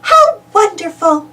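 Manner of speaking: a hint of thrilled princess; Daisy's voice. Daisy Beauty greeting her rescuer, delighted. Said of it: "says Daisy Beauty".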